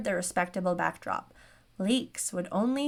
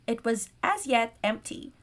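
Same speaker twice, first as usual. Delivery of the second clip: ordinary talk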